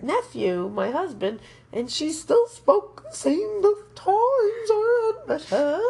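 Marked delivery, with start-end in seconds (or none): 1.7-5.8 mock posh accent. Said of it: "sounded like Catherine Hepburn".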